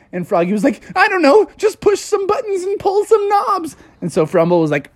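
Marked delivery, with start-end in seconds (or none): 1.0-3.8 very high cartoon fretting; hammed-up frantic voice; panicked frog. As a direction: blurt instructions in a high panic. Froggy panics in the chase.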